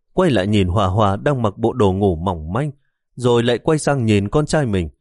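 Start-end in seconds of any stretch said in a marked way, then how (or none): none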